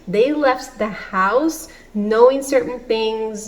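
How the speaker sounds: normal talking voice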